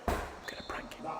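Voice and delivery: whispered aside to the viewers. Real, not acted. Son secretly telling viewers he'll prank Dad, whispered. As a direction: breathe it so Dad can't hear.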